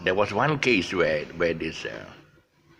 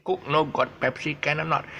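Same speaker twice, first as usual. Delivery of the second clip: higher Malaysian Manglish phrasing; 8 semitones higher than usual. Retold or imitated. Retelling what the waiter said, in Manglish. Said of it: "She said".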